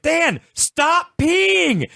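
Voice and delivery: high, drawn-out mock yell; retold yelling. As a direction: imitate her yelling at you to stop. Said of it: "she started yelling at me".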